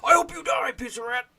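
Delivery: shouted at a character; mock yell. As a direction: yell it out in jest. Yelling at the rat.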